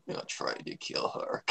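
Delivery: low, slow smug whisper. Shown as a demonstration. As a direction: breathe it out smugly and slowly. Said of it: "I'm trying to just be smug here".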